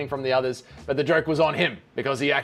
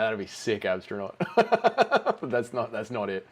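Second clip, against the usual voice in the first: usual speaking voice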